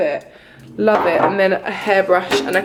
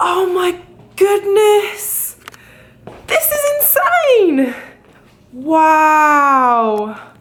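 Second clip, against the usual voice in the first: high, drawn-out gasp of amazement; awestruck; 7 semitones higher, much slower than usual. Real, not acted. Traveller stunned by her hotel room, high and amazed. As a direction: marvel at the room.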